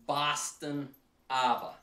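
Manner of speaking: put-on slow, drawn-out Boston accent. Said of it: "That's what it actually says right there".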